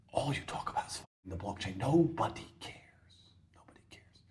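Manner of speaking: scornful whisper. Real, not acted. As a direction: hiss it with contempt. Whispered contempt.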